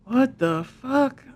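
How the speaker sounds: high and drawn-out, slow; stunned what the fuck. Retold or imitated. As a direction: let the shock hang on every word. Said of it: "Man, I'm like".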